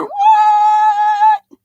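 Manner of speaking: very high, loud whoop; piercing high-pitched cheer; excited shriek. Real, not acted. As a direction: squeal it in delighted surprise. Mock shock at friend's arrival.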